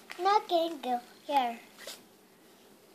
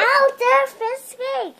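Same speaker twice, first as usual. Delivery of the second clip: loud; 7 semitones higher, 7 dB louder than usual. Real, not acted. Child complains of bite.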